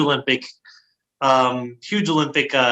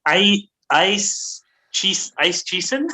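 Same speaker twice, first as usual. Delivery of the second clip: slow, higher, halting guess; unsure pronunciation; 5 semitones higher, much slower than usual. Real, not acted. Host with no German trying a name, tentative. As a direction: sound out the foreign name haltingly. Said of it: "I think".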